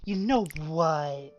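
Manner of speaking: put-on slow, loud sarcastic girly voice; drawn-out girly sarcasm. Shown as a demonstration. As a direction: put on a girly voice, drawing words out. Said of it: "in a girly voice".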